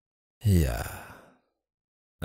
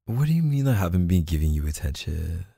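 ordinary delivery

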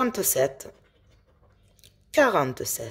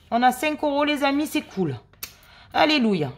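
excited win; 7 semitones higher, faster than usual. Real, not acted. Wins five euros.

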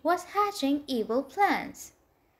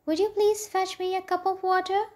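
high, evenly pitched polite question; polite request; 7 semitones higher, faster than usual. A narrator's reading. Princess asking her maid for water, polite. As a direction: ask sweetly for a small favour.

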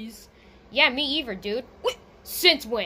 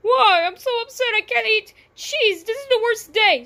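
pretend crying; 7 semitones higher, 4 dB louder than usual. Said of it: "[name]'s crying right now".

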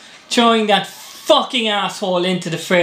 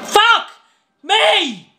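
exasperated shout; 10 semitones higher than usual. Real, not acted. Gamer raging at the game.

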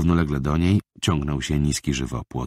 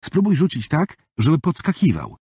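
put-on much higher boyish coaching; a small boy teaching a friend; young boy's voice; 11 semitones higher than usual. (wysoki głos chłopca, który uczy)

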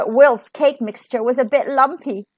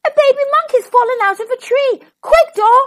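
high, fast, panicked exclamation; alarmed storybook shouting; urgent yell; 12 semitones higher, 5 dB louder than usual. A narrator's reading. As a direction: yell for help in a hurry. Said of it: "he yelled".

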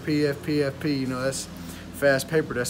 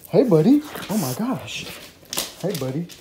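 talking to dog; 2 semitones higher than usual. Real, not acted. Greeting his dog again.

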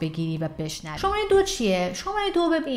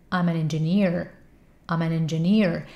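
quicker contracted practice; faster, contracted; 2 semitones lower, much slower than usual. Shown as a demonstration. Teacher showing the faster contracted step. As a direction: speed it up and contract I'm. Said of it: "بعد تندترش کن".

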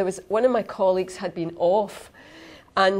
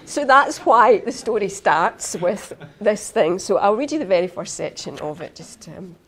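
normal talking voice

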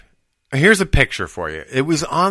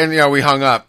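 ordinary delivery

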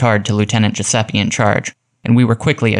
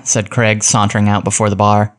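ordinary delivery

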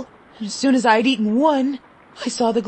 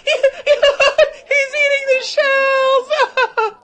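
loud, high, slow laughing cry; the crowd laughing at a boy crunching shells; laughing shout; 6 dB louder, much slower than usual. A narrator's reading. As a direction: crow it out through laughter. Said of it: "laughed the crowd".

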